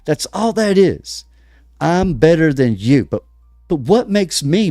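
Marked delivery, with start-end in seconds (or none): none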